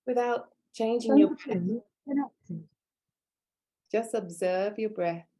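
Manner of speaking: measured meditation voice, long silences between instructions; guided meditation. Real, not acted. Teacher grounding students at a training's opening, guided meditation. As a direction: guide their breathing in calm, spaced phrases.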